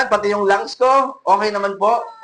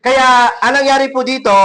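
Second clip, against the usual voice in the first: preaching emphasis; 7 semitones higher, 6 dB louder than usual. Acted. Preacher driving point home.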